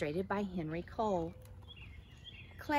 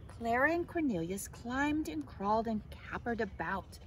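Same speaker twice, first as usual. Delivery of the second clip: usual speaking voice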